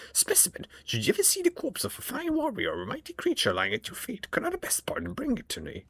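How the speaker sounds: high, eerie surgeon creature voice; creepy homunculus voice. Acted. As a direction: voice a gloating flesh-crafter, high and strange. Homunculus voice continues.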